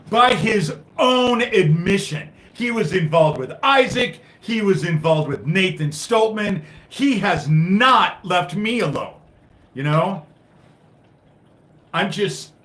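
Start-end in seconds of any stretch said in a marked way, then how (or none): 0.1-9.1 angry accusation. Real, not acted. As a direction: hammer each accusation out in anger. Accusing sheriff's friend.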